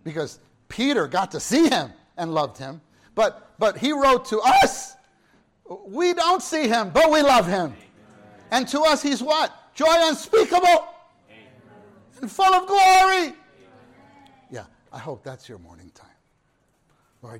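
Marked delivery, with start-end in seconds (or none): 3.1-13.3 building from loud to a ringing shout, pitch climbing; rising preaching fervor. Real, not acted. Preacher lifting the congregation toward joy, building to a full shout. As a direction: preach it higher and louder until it rings out.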